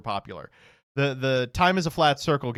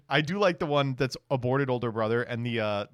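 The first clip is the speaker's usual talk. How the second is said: ordinary talk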